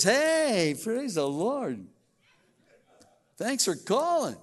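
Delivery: high, loud sudden sweetness; fake sweet phone voice. Mom on the phone after screaming at dad, fake sweetness. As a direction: switch instantly from screaming to sugar.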